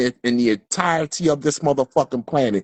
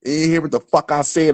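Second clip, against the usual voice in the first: ordinary delivery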